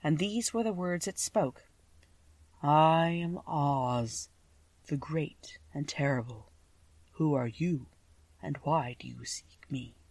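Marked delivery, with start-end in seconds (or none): 2.6-10.0 slow, hushed voice of Oz; low, quiet voice. A narrator's reading. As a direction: speak slowly and softly, nearly whispering at phrase ends. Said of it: "a low, quiet voice".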